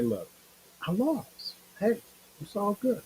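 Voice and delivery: high, light, easygoing "it's all good" voice; imitating a humble fighter brushing off a loss; mock shrugging loser.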